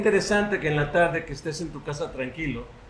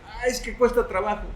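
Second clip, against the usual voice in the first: higher, sighing excuse; mock complaint; 6 semitones higher than usual. Listener objecting that study takes work, mock complaint. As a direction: imitate someone moaning that it's hard. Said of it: "Tal vez tú digas".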